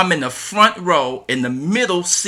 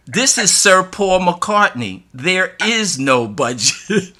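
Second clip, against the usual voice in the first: slow, louder, higher mock amazement; amazed disbelief; 6 semitones higher, 4 dB louder, slower than usual. Road manager answering the principal about a budget, amazed and emphatic. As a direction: spell it out as if the question is absurd. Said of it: "with this look of amazement and said".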